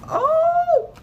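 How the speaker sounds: thrilled outburst at the cards; excited shriek. Real, not acted. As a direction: squeal with real excitement. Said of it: "Sorry, I'm just really excited".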